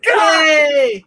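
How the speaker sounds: loud, high yay; real shouted cheer of relief; excited cheer. Real, not acted. Player cheering as friends can hear again, excited cheer.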